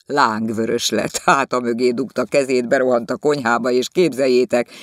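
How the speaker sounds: eager hostess gossiping; excited gossip. Acted. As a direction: dish the story to the guests.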